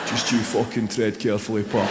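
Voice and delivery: menacing Glaswegian. Retold or imitated. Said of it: "People going".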